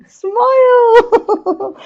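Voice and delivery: high, loud, long "Smile!" with laughter; gleeful exclamation. Shown as a demonstration. Coach revealing her final tip to the class, gleeful and high. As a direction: sing it out gleefully, drawing out the vowel.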